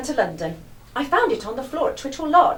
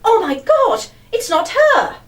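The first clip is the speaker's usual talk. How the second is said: loud, higher, alarmed exclamation; shocked cry; 7 semitones higher, 10 dB louder, slower than usual. Acted. Lizzie sees the killer instead of Mary, shocked loud cry. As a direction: blurt it out in sudden fright. Shocked when wrong person arrives.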